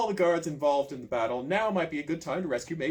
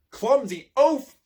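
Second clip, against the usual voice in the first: high, loud, slow scold; angry insult; 11 semitones higher, 10 dB louder, much slower than usual. A narrator's reading. Sheriff scolding the clumsy page, loud and high. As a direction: bite out each word in disgust.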